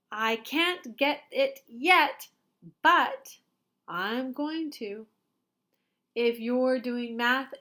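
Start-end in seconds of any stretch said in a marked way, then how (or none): 0.1-5.1 hopeful. Shown as a demonstration. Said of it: "what you should try to say instead".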